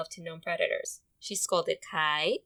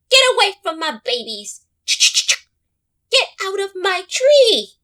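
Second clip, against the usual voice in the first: high, loud furious scolding; a mother squirrel yelling at a predator; 11 semitones higher, 15 dB louder than usual. Acted. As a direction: chase the intruder off with a shriek. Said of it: "No one could scold like old Mama Squirrel".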